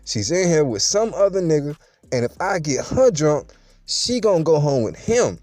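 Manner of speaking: ordinary delivery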